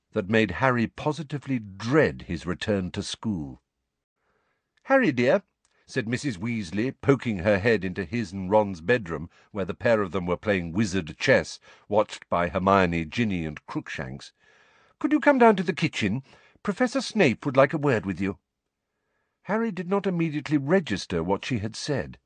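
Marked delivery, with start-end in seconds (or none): 4.8-5.4 high, louder motherly call; a lightly voiced mother calling someone in. A narrator's reading. Mrs Weasley calling Harry from the doorway, motherly. 15.0-18.4 high, quick motherly voice; motherly request. A narrator's reading. Mrs Weasley summoning Harry to the kitchen, motherly.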